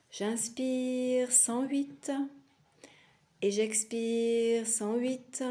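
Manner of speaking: slow, drawn-out counting with the breath; measured breath count. Shown as a demonstration. Teacher modelling a backwards breath count for students, slow and drawn-out. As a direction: stretch each phrase to the length of a breath.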